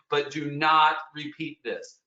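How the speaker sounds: but do not repeat this, slow and leaning on not; stress on not. Real, not acted. Guide repeating a warning, slow stress on not. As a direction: spell it out slowly, firm on not.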